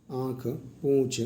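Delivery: आँख and पूँछ very slowly, nasal vowels drawn out; sounding out nasal words. Shown as a demonstration. Teacher giving students chandrabindu examples, very slow. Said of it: "उदाहरण के लिए".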